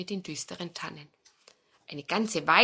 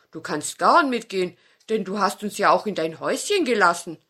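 put-on louder friendly old dog; kindly old dog; 7 dB louder than usual. Old dog lets nutcracker come, friendly dog voice. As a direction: grant the wish kindly.

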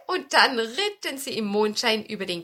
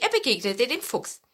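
usual speaking voice

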